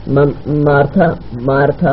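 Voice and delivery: slow, halting, subdued repetition of a name; distressed stammer. Acted. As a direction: falter on the name, troubled. Distressed woman stammers friend's name. (رک رک کر، پریشانی سے)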